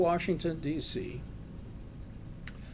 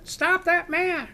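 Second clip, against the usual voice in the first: high, slow, loud retold scream; 5 dB louder, slower than usual. Bank teller screams again after the man, high loud scream. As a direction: shriek it again even louder, desperate. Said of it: "she screams".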